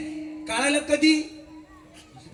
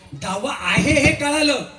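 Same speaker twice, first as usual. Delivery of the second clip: ordinary delivery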